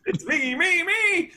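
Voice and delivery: high, loud mock teen whine of self-importance; self-centred teenager. Host mocks teenagers who care only about themselves, high and loud. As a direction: squeal it like the centre of the universe.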